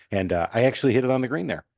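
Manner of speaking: ordinary talk, nothing marked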